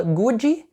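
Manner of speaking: GOOD as "goo-djee", extra final vowel; mispronounced good. Shown as a demonstration. Teacher imitates a learner's error, good mispronounced. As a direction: say "good" in two syllables, ending in EE. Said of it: "a pessoa erra".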